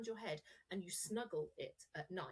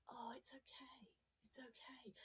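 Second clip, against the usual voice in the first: whispered, higher, comforting; soothing whisper; 5 semitones higher, 14 dB quieter than usual. Shown as a demonstration. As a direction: reassure a baby in a whisper. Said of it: "you say to a baby when it's upset".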